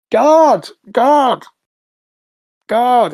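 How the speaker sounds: loud, high call in separate bursts; shouting. Acted. Handcuffed prisoner calls out for his captors, shouting. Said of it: "And he starts shouting".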